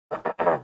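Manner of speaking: quick throat clear. Real, not acted. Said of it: "I have a bad throat".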